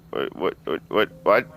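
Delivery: ordinary delivery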